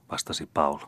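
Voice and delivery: plain reading voice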